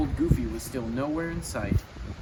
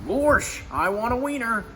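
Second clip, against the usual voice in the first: much higher goofy voice; 8 semitones higher than usual. Retold or imitated. Goofy at the hot dog stand, high goofy voice. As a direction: say it like a hungry Goofy.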